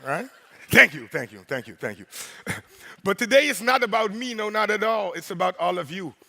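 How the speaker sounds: ordinary talk, nothing marked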